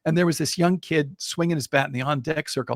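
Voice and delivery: usual speaking voice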